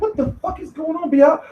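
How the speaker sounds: high, softened, incredulous squeal; mock bewilderment. Fan questioning boxer's cryptic answer, mock bewilderment. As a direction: ask it in high-pitched, baffled disbelief. Acted listener baffled by B-Hop.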